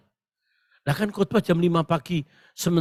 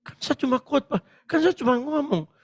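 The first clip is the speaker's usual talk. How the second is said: higher, plaintive self-excusing; mock rattled protest; 8 semitones higher than usual. Rattled preacher tells God he only preached, high mock protest. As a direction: re-enact a comic plea to God. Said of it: "Saya keder juga".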